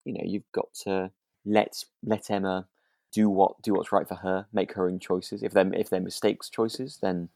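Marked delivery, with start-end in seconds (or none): none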